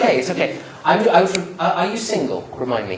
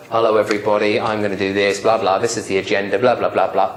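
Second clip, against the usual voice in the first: lower, droning run through the agenda; boring opening; 6 semitones lower than usual. Shown as a demonstration. Trainer mocking a dull presentation start, droning. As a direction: drone like a bored presenter.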